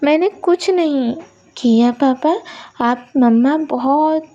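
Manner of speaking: put-on a girl blaming her crying mama to papa; earnest child's excuse; little girl defending herself. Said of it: "अब फुग्गी कहती हैं".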